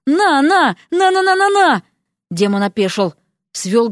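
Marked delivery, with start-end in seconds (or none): 0.0-1.8 high, rapid-fire battering cries; high-pitched attacking chant; frenzied. A narrator's reading. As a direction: jab each syllable in a frenzy. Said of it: "остервенела".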